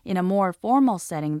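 ordinary talk, nothing marked

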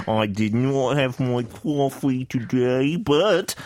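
slow, unplaceable put-on accent; lightly hammed-up mixed British accent, slow; put-on Tom Hardy accent. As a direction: play it like an actor with an unplaceable accent. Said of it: "what is this accent you're doing".

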